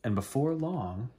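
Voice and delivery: ordinary delivery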